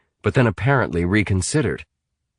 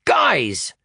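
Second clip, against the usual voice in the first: snapped; 6 semitones higher than usual. A narrator's reading. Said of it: "snapped Atticus".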